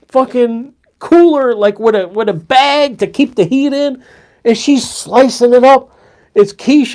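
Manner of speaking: high, amazed gushing about the food; excited gushing. Real, not acted. To Sam, raving about the quiche at the new job, high and excited. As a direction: rave like you can't believe your luck.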